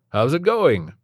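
high, loud cheery greeting; chirpy doctor. A narrator's reading. Doctor cheerily asks Stephen how it's going. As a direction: sing it out like an overly cheerful doctor. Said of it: "overly chirpy and grating voice".

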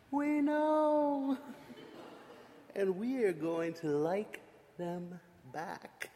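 soft conspiratorial giggle; sly giggling. Acted. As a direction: giggle it knowingly.

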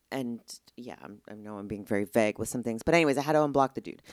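usual speaking voice

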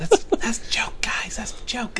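stage whisper to listeners; hushed aside. Pretend.

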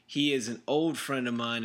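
ordinary talk

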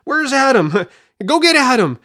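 high, urgent mock warning to a character; hammed-up yelling at a movie heroine; mock warning shout. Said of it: "alarm bells are going off in everyone's head".

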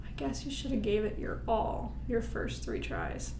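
soft, sarcastic repeat of the official; mocking echo. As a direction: echo his words back with quiet scorn. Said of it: "I'm like".

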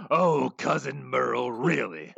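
slow, pompous theatrical villain; weary, disdainful sophisticate sighing at a fool; slow haughty villain exasperation; Sideshow Bob voice. Retold or imitated. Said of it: "That was a pretty good Bob".

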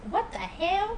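mock high far-off What the hell, rising; teasing copy of the student's exclamation; imitating student's What the hell. S3 imitates Janice to the hosts, rising mock exclaim. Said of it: "She says".

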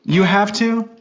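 high-pitched, startled disbelief; incredulous. Real, not acted. Dad reacting to daughter's forced affection, incredulous. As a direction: shoot up high in astonished disbelief.

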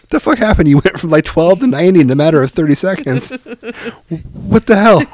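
high-pitched mock bafflement; comic disbelief. Dad questions son's sudden aging, comic disbelief. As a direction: exclaim as if utterly baffled.

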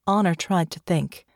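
plain reading voice

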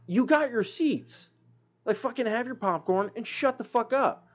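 exasperated retort. Retold or imitated. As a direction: drag it out like you've had enough. His own retold annoyed retort.

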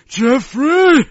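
loud, high haughty "Jeffrey!" called out. Pretend. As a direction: bellow "Jeffrey!" like a stuffy English aristocrat. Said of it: "I think".

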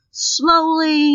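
drawn-out, deliberate word; acted slow, careful step; slowly. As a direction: stretch it out as if carefully testing. Acting out careful attempts at door.

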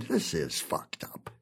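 very soft, low, to himself; a hushed private thought; muttered aside. Acted. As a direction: mutter it under your breath.

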